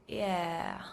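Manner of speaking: "yeah," dipping low and then rising; a reluctant "yeah," kept low; "yeah," falling then rising, as if forced to agree; dipping third tone. Shown as a demonstration. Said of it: "like saying an enthusiastic".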